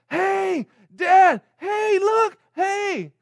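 put-on high, loud, eager child's shout; excited little girl. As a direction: call out like a thrilled four-year-old. Daughter excitedly spotting hay.

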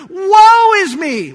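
loud, drawn-out cry of woe; cried out. Acted. Isaiah cries out before God's throne, loud drawn-out lament. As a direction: cry out high and long. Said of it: "He cries out".

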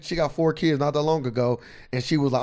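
ordinary talk, nothing marked

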